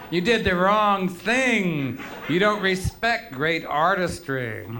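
high, loud, drawn-out reproach; hammed-up snob telling someone off; mock scolding. Concert snob to a wrongly timed clapper, mock scolding.

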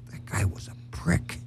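soft unvoiced whisper. Leaving, he whispers to himself, unvoiced whisper. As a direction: breathe it under your breath. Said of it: "I whisper to myself".

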